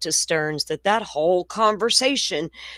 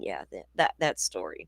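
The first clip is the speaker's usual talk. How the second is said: usual speaking voice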